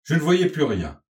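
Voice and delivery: ordinary delivery, nothing marked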